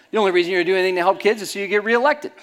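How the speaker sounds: loud, high, triumphant accusation; mock gotcha turned back. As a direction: throw his own gotcha right back at him. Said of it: "I said".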